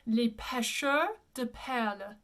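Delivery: slow, careful Les Pêcheurs de Perles; Les Pêcheurs de Perles as "lay peh-shur duh pairl"; French pronunciation. Shown as a demonstration. Teacher models the difficult French title, careful pronunciation. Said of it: "the pronunciation in French is".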